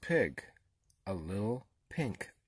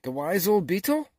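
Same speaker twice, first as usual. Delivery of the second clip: loud, higher, shocked question; gasping animals; 9 semitones higher, 10 dB louder than usual. A narrator's reading. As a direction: echo it in alarm, rising at the end. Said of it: "gasped all the animals".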